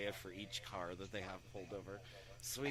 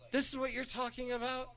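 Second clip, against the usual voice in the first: pitched way up in disbelief; incredulous question; 13 semitones higher, 3 dB louder than usual. Retold or imitated. Officer shown a tiny nug of weed, incredulous. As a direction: imitate a cop who can't believe it.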